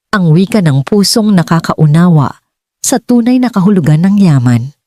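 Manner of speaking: usual speaking voice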